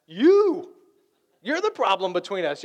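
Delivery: loud, high accusing jab; accusing shout. Retold or imitated. Answering a spouse's question with a loud blaming shout. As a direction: point the finger hard. Easy answer to what's the problem.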